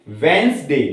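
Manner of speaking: वेंसडे as "wens-day"; correct pronunciation. Shown as a demonstration. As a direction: say वेंसडे firmly, first D silent. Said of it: "क्या बोलते हैं".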